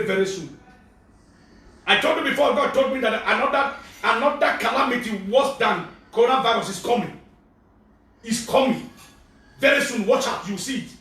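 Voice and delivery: ordinary delivery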